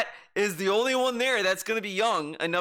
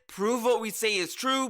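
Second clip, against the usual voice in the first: ordinary delivery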